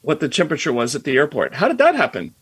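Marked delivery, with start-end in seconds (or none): 1.6-2.3 mock-surprised realization, much higher and a bit louder. To podcast listeners, mock-surprised realization. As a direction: act out the sudden discovery with a pitch jump. Realizing IoT existed long ago.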